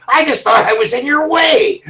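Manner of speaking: laughing through the words; cracking up at his own story. Real, not acted.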